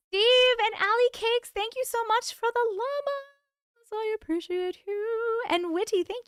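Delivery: high, sing-song thank-you; gushing thanks. Real, not acted.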